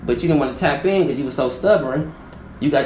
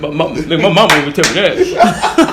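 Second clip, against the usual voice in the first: mock macho man; 5 semitones higher, 8 dB louder than usual. Mimicking a stubborn man who won't check in, laughing. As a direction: ham up the proud guy invoking his mama.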